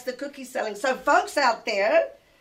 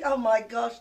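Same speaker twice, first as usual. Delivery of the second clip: ordinary talk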